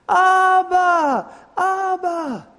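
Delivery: put-on high, loud child's cry of Abba; a child's piercing cry for his dad; child screaming. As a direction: scream it like a little boy calling his father. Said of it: "what he screamed out".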